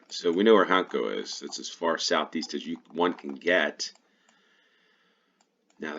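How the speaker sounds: ordinary talk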